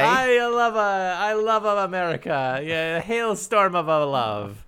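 put-on loud, hammy Italian accent. Mocking Italian guest voice.

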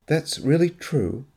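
slow, doubting question; disbelief. Acted. Anne doubts Graham's confession, slow disbelief. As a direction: she can hardly believe him. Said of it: "disbelief still in her voice".

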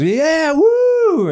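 high, loud party cheer; cheering woo. Retold or imitated. Telling the crowd which drugs earn a cheer, a loud high woo. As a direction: whoop it up like a crowd. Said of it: "you could say, like".

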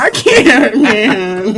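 loud, high words through laughter; real, helpless laughing protest; cracking up. Real, not acted. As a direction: gasp it out laughing hard. Cracks up at rat joke.

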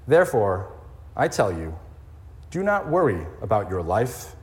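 scripture reading. Acted. Reading Matthew six to the congregation, solemn. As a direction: read Jesus' words reverently. Jesus says these words to you.

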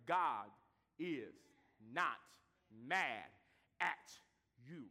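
God is not mad at you, every word set apart with a pause; each word alone. Shown as a demonstration. Preacher drives home to the congregation, slow and deliberate. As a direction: spell it out one word at a time. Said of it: "word by word emphasis".

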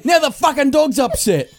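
high-pitched mock outrage; irate dad. Dad blames son for upsetting the dog, mock outrage. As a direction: imitate a father's exasperated holler. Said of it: "And then he'd say".

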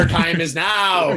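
Magneto. Acted. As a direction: declaim loudly with a mock Irish accent. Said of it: "Whenever Magneto".

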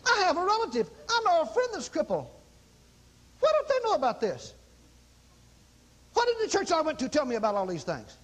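high, urgent indignant complaint; acted astonished grievance, pitched high; agitated churchgoer. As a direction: protest as if they should have told you. Acted indignant churchgoer after a healing.

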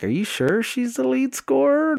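high-pitched disbelieving question; incredulous challenge. Acted. Coach wants to dispute the dad's claim, squeaky incredulity. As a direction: swoop up high in mock disbelief. Acted doubting challenge to dad.

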